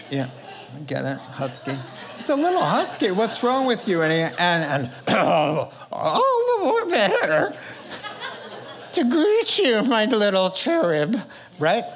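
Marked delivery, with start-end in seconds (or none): 2.2-4.0 put-on shrill little girl. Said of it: "she says". 5.9-11.1 falsetto wolf as grandma. Acted. Wolf in Grandma's bonnet sweet-talking the girl, hammed-up falsetto. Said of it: "the wolf says".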